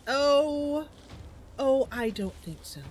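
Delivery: lightly acted objection, high loud drawn-out "Oh"; Losa. Losa refuses an enemy's attack on Mari, lightly acted objection. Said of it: "Losa says".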